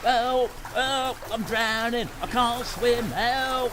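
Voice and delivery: staged panic in the water; drowning cries. Acted. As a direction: scream as if going under. Drowner performs his drowning.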